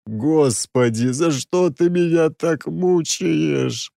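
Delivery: slow, moaning lament. Acted. Oleg moans to God about the prince, slow groaning lament. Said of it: "простонал я".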